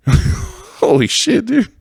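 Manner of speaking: high squealing laugh through the words. Real, not acted. Laughing about Carl videos, high squealing laugh. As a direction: crack up while saying it.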